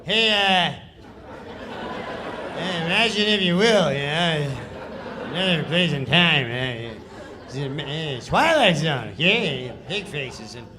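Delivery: careless, offhand mumbled read with laughs; Norm's shrugging, half-hearted Twilight Zone read; Norm's lazy read. Retold or imitated. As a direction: throw the lines away like you don't care. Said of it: "this is how he reads the sketch".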